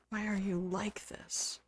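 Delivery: slow exasperated whisper. Real, not acted. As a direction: whisper it in disbelief. Exasperated at game grandma.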